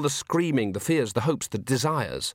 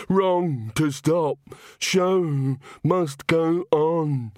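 fixated murmur; 3 semitones higher, much slower than usual. A narrator's reading. Walter insisting to Granny the show must go on, fixated. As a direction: repeat it like a stuck prayer.

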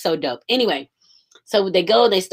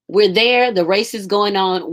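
ordinary talk, nothing marked

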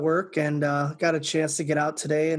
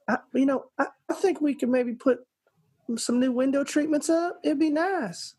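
high-pitched Southern lady impression; Southern drawl; 9 semitones higher than usual. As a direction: put on a high, gentle Southern twang. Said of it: "she gets in that drawl going".

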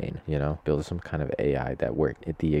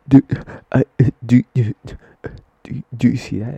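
shocked; 8 semitones higher, 13 dB louder than usual. Retold or imitated. Stunned at finding dead bird.

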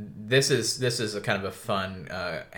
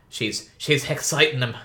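higher, louder Cockney cabbie; Cockney cabbie accent; 6 semitones higher, 6 dB louder than usual. Acted. Cabbie complaining about the horses, Cockney accent. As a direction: put on a Cockney British accent, pitched up. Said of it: "very Cockney kind of British accents".

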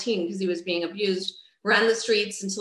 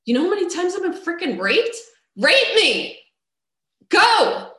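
rising from a tight dare to a full shout; growing defiant yell at the man with the knife; 8 semitones higher, 5 dB louder than usual. Retold or imitated. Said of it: "And I said".

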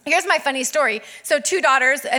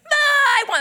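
high, loud mock scream of a child; imitated toddler scream; 14 semitones higher, 5 dB louder than usual. Mom imitates daughter screaming for the other's snack, screaming. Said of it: "Selah just screams".

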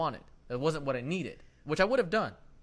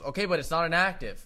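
raised-voice imitation of an insistent clerk; retold loud insistence; 5 semitones higher, 9 dB louder than usual. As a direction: play the clerk pushing back, loud and higher. Clerk quoted in retold quarrel.

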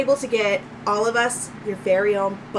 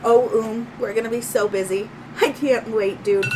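ordinary talk, nothing marked

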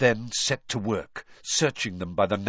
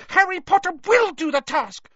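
loud, very high, insistent elf; determined house-elf squeal; Dobby squeaking; 7 dB louder than usual. A narrator's reading. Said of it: "squeaked the elf".

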